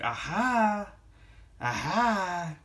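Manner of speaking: high, airy knowing laugh-word; barbershop side character voice. Retold or imitated. Reviewer imitating the barbershop guy from Coming to America, high and knowing. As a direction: toss off a sly high "aha". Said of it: "I just think of Eddie Murphy's character".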